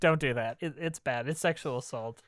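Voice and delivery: low sober disclaimer. Real, not acted. Host retracting a joke about grabbing, low disclaimer. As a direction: drop right down after the joke.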